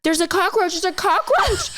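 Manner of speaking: high, rising frantic report; retold panic. Driver explains to armed officer, retold panic. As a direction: re-enact panicked pleading through a laugh.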